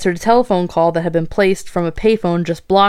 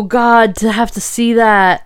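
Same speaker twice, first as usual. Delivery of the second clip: usual speaking voice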